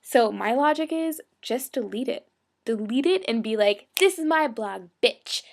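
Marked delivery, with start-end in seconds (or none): 4.0-5.4 higher, louder mock comeback; sassy defiance. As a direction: stake your claim with attitude. Acted defiant reply to hate senders.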